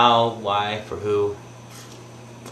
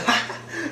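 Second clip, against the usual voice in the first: high mock cackle; imitated laughing; 6 dB quieter than usual. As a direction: burst of hysterical mock laughter. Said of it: "laughing in the midst of it".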